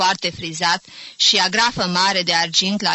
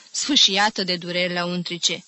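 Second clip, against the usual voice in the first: ordinary delivery, nothing marked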